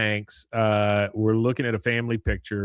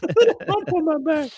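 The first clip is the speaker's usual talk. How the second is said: much higher squeaky repeat with a laugh; exaggerated Luann; 5 dB louder than usual. Podcaster repeating Luann's line, much higher and laughing. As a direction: overdo the girl voice through laughter. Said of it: "That's funny".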